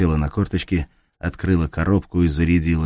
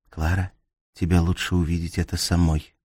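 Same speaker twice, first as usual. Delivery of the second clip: plain reading voice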